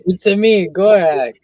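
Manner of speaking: loud, high hammed-up Roman Bellic accent. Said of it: "He's Roman Bellic".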